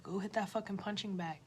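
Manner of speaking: ordinary talk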